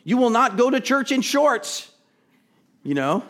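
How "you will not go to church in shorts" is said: higher, louder stern mom laying down house rules. Retold or imitated. Preacher imitating his mom's house rules, stern and loud. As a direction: boom it out like a strict mother. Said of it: "It's just like my mom saying".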